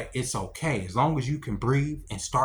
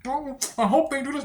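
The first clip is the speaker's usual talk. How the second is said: mocking hopeful voice; 12 semitones higher than usual. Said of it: "we just sit here and we just".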